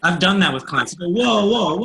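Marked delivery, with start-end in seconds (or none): 1.1-1.8 imitated client protest, high and loud; alarmed protest. Clients halt the coach's questioning, alarmed protest.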